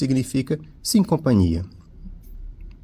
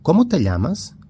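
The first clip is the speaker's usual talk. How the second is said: Como te llamas? with a big rising-falling question tune, higher and louder; 6 semitones higher, 3 dB louder than usual. Shown as a demonstration. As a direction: ask Como te llamas? warmly. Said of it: "advérbios interrogativos".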